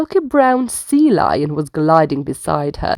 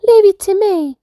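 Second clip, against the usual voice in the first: high, louder call back; called over shoulder; 12 semitones higher, 5 dB louder than usual. Acted. Little sloth calls back to mama while running off, high call. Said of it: "she called over her shoulder".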